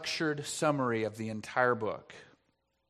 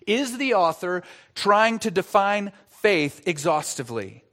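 ordinary talk, nothing marked

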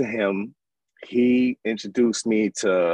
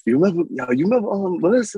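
higher, louder excited friend; voicing homeboy Gary; 9 semitones higher, 3 dB louder, faster than usual. Retelling to the host, friend's excited high voice. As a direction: mimic the friend breaking the news. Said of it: "he was like".

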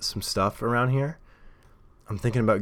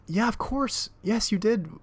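much higher, sing-song placating voice; mock reassuring; slower than usual. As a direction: soothe him in a high, humoring tone. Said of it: "I just wanted to be like".

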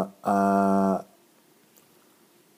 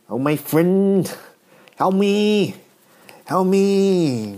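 badly faked German accent, higher plea; attempted German accent; 5 semitones higher, slower than usual. Comedian calling on a German friend, failed German accent. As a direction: try and fail at a German accent. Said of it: "You can't do the German accent".

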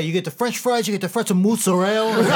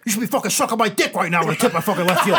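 New Jersey mobster; 3 semitones higher, 4 dB louder, faster than usual. Pretend. Sopranos mobster rant.

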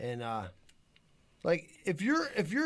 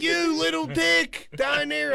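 high, slow imitation of a taunt; mock insult yell; 7 semitones higher, 3 dB louder, slower than usual. Storyteller imitates the woman in the car, mock insult yell. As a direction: stretch the jeer out, pitched way up.

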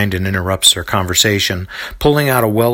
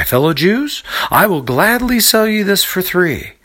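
eager vendor; 10 semitones higher than usual. A narrator's reading. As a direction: coax the strangers like a market hawker. Vendor offering a special price.

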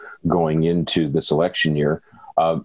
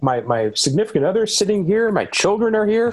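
much higher-pitched mock amazement; mock astonishment; 12 semitones higher than usual. Co-host acts out discovering the family at home, mock astonished. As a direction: play a sudden, astonished discovery. Acting out a sudden realization at home.

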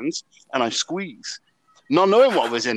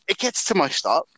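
ordinary talk, nothing marked

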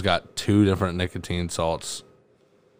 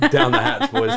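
laughing. Real, not acted. Laughing as they eat candy.

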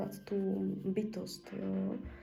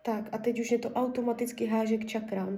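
ordinary talk, nothing marked

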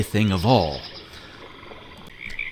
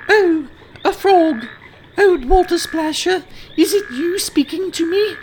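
put-on very high, loud girlish princess; young princess voice; 5 dB louder than usual. Princess surprised to find the frog speaking, very high girlish voice. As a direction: squeak it out like a startled little princess.

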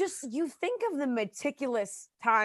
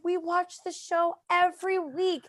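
put-on little girl voice; 7 semitones higher, slower than usual. Six-year-old quoted.

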